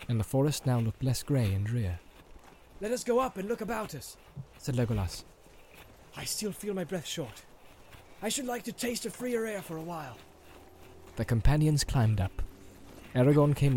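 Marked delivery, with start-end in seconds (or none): 2.8-10.2 high, airy elf; eager to breathe freer air; light elf voice. A narrator's reading. Legolas longs for fresh air.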